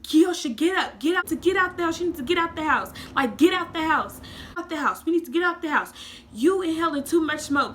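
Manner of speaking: high, loud panicked orders; bossy sister yelling to get out; frantic big sister. Retold or imitated. Sister yelling at her to leave the smoky house. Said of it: "tripping out on me".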